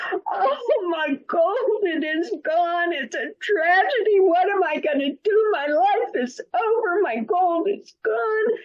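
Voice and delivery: high, crying lament; tearful lament. Acted. Abdullah telling the villagers his gold is gone, crying lament. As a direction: wail each line like the world ended.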